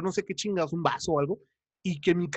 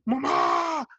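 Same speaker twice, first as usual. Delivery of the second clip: grito de hermana sonámbula; 10 semitones higher than usual. Retold or imitated. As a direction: shout for mom in a panic. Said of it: "salía corriendo acá al cuarto de mis papás a gritarle". (grito desesperado llamando a mamá)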